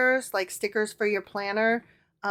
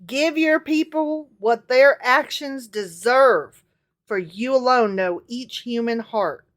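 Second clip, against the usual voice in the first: scripture reading; 4 dB louder than usual. Acted. Reads scripture aloud.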